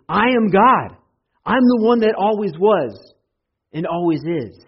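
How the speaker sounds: high, loud declaration; a thundering divine claim; proclaiming. Acted. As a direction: proclaim it with full authority. Voicing Jesus proclaiming.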